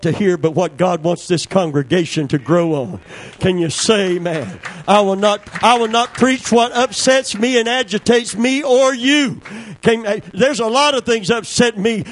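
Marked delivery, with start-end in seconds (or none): 4.9-12.0 higher, louder insistent preaching; full-force preaching. Real, not acted. Preacher vowing to the congregation, insistent preaching. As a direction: declare it firmly.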